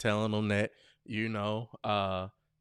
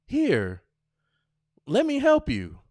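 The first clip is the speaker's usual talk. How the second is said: sweet, higher helpful voice; high sweet offer; 11 semitones higher, 5 dB louder than usual. Shown as a demonstration. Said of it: "Instead of saying".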